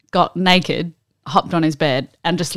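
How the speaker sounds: ordinary talk, nothing marked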